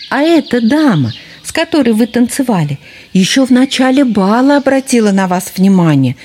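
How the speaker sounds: high, quick, pushy fox cub voice; cheeky fox cub. Acted. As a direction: butt in brightly and high. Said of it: "бесцеремонно влез в разговор лисёнок".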